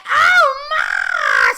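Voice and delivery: very high, very loud shriek; screamed. Retold or imitated. Girlfriend's mom seeing the scene, loud shriek. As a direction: shriek in shock. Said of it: "her mom screamed".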